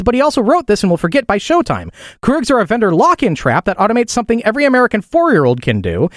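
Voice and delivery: lifted, emphatic radio news delivery; news read. Acted. Host reads listeners the smashed coffee maker story, news read. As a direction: read wry news copy with punched key words.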